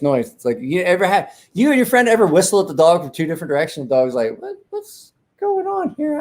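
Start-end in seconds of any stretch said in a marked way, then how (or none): 4.4-6.2 very high, slow dog voice; high-pitched comic confusion; puzzled dog voice. Retold or imitated. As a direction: squeak it like a baffled dog.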